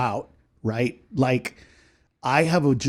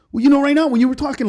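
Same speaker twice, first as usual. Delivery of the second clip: high, fast mock accusation; high put-on voice, insistent; offended heckler; 11 semitones higher, 4 dB louder, much faster than usual. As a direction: rush it out like an aggrieved complainer. Heckler's reply in acted dialogue.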